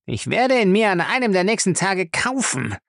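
high, tense threat; angry, through clenched teeth. Acted. Lacey vows to get Featherstone, angry through his teeth. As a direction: force it out between the teeth. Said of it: "stieß er zwischen den Zähnen hervor".

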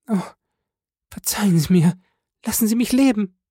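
high, frightened woman's voice; desperate plea. A narrator's reading. Wife begging for her life.

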